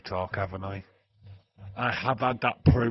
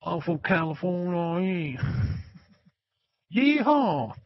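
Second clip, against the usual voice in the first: put-on southern accent; 10 semitones higher, 6 dB louder than usual. Showing off a favourite accent, loud high southern US twang. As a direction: whoop it up as an American southerner. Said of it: "I just like the southern accent".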